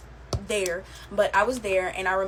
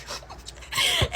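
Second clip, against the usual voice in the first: high laugh. Real, not acted. Laughs at her own story.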